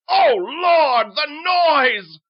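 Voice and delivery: high, very loud wail; agonized scream; anguished cry. Acted. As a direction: cry out in torment. King tormented by the noise.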